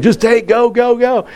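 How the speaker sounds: high, eager go go go; imitating rowdy guys urging him on. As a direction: hurry the driver along. Rowdy passengers urging driver on.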